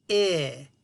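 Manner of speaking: I said so the voice box buzzes; vowel demo. Shown as a demonstration. Teacher to children, testing I for vibration. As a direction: feel the I vibrate. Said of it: "So try the first one".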